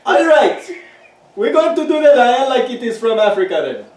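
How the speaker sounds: loud, high, hammed-up African-style accent; mock African accent. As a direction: put on a random African-ish accent, loudly. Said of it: "random accent I'm doing in relation to Africa".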